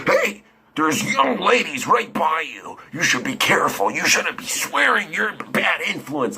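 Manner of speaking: high-pitched put-on voice of an indignant stranger; mock scolding. Man scolding swearing teens near young ladies, high mock indignation.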